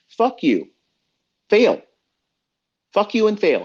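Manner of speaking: loud, high, cutting retort; defiant shout. Acted. Shouting back at listeners who dislike his long rants, defiant and loud. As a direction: shout it straight at the haters.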